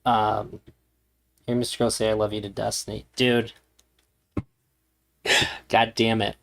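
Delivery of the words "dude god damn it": ordinary talk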